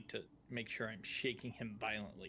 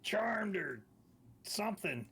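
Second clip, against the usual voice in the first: high, loud in-character yell; shouting back; 10 semitones higher, 5 dB louder than usual. Acted. Player reporting charmed friends to Casimir, shouting back. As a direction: yell the news back across a distance.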